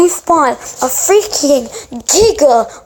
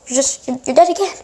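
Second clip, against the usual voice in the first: ordinary talk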